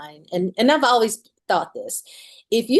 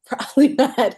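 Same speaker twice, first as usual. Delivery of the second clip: louder, higher repeat with a laugh; 6 semitones higher, 4 dB louder than usual. Shown as a demonstration. Guest repeating her answer to the interviewer, laughing. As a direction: laugh it out as the punchline.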